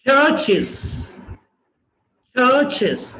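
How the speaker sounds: चर्चेस with breathy first chh; aspirated ch. Shown as a demonstration. Teacher models चर्चेस aspirated. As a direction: say चर्चेस as chharches. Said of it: "चर्चेस नहीं है".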